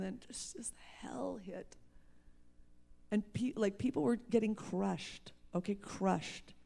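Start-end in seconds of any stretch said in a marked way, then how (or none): 0.0-1.7 dramatic whisper. Real, not acted. Dramatic turn in testimony.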